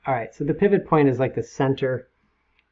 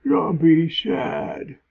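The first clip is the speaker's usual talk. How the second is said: sad zombie voice; 4 semitones higher, much slower than usual. Pretend. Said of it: "Zombie voices".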